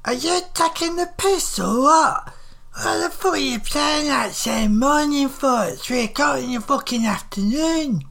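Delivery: put-on high-pitched northern old woman in outrage; livid. A narrator's reading. Old woman tells off a man for saying morning, livid. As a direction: berate him in a high, indignant voice. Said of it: "She was livid".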